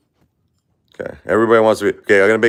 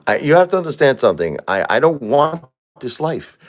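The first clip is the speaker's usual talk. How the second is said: upset outburst; 7 semitones higher than usual. Real, not acted. Grieving father rants on livestream.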